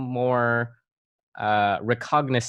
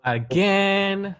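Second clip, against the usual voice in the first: higher, louder mock alarm; mock dismay; 8 semitones higher, 4 dB louder than usual. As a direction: overplay the shock. Mock dismay, loud and high.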